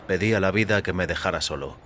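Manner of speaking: ordinary delivery, nothing marked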